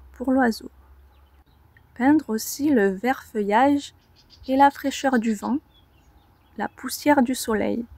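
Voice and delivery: measured poetry reading, long pauses between lines; careful, deliberate verse delivery with expressive swells; poem recitation. Acted. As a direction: recite it line by line, lifting key words. On va commencer par une lecture.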